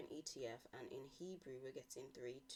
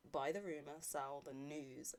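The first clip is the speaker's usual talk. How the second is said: ordinary talk, nothing marked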